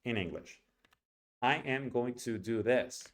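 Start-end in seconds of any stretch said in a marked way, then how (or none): 1.4-3.1 every word fully pronounced with Spanish-like even timing; Spanish rhythm. Shown as a demonstration. Teacher demonstrating the wrong way, Spanish-accented even syllables. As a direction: sound out each word equally, Spanish style. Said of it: "First in Spanish".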